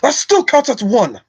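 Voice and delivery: high, indignant dwarf outcry; Gimli. Acted. Streamer's first try at the dwarf's line, Gimli voice. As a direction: protest it high and strained, like an offended dwarf. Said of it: "I can do my Gimli voice".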